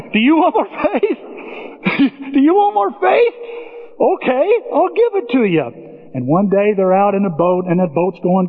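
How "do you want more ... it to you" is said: high laughing mock Jesus; high amused mock Jesus; laughing tease. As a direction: laugh while teasing them.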